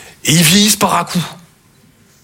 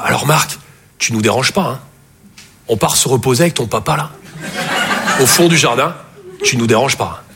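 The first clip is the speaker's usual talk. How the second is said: discreet mother; 6 semitones lower than usual. Acted. Mother at child's bedroom door.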